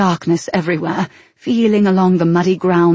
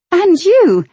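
bright and high; 12 semitones higher, 6 dB louder than usual. Acted. Bright greeting back.

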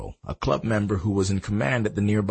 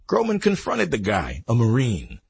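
plain reading voice

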